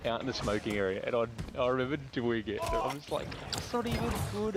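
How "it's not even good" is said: higher, mocking scoff; scoffing roast. Roasting the girls at the bar, higher scoffing sneer. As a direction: sneer that it's no good. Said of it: "roasting them more".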